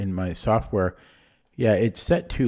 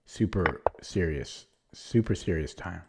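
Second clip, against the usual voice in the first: mock serious; 5 semitones lower, 7 dB quieter than usual. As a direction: play at being dead serious, a touch softer. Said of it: "Okay, really focusing now".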